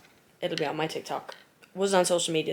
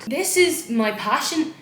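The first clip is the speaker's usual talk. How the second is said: loud, slow proud declaration; excited proud shout; 8 semitones higher, 8 dB louder, slower than usual. Real, not acted. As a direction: belt it out like a proud DJ. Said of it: "I feel so fucking cool".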